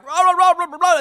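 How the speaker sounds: loud, high, wordless mock yelling; hammed-up gibberish ranting off mic; Tim Scott. Host mimics a candidate's ambient ranting, mock yelling. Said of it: "ambient sound of Tim Scott going".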